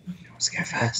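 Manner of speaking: soft unvoiced whisper. Real, not acted. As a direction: hush and whisper it. Whispered question.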